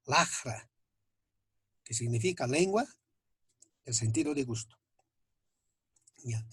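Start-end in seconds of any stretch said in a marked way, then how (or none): 0.1-0.7 "lajra" with a rasped back-of-throat j; postvelar X. Shown as a demonstration. Teacher giving the Aymara word for tongue, postvelar fricative. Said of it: "ejemplo en aymara".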